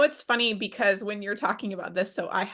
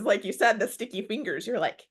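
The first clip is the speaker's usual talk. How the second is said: ordinary talk, nothing marked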